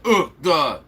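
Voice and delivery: dumb mocking repeat of "Oof, duh"; mocking echo. Echoing a viewer's odd phrase back in disbelief, mocking. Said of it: "Who the hell said".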